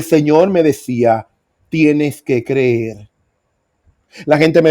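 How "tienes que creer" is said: very slow, solemn divine command; voice of the Lord. Retold or imitated. Preacher retelling God's words to him in his illness, slow and solemn. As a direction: lay out each word slowly, like God speaking from above. God speaking to him in vision.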